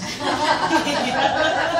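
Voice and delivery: a high rising giggle; real laugh. Real, not acted. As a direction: giggle at the line you just read. Laughs after reading a line.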